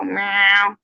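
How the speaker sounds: high playful meowing; mock cat meows. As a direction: squeak out a cat's reply. Cat tries to answer.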